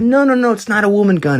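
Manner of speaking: high hammed-up protest; mock insistent high voice; squeaky mock reassurance. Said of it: "They said".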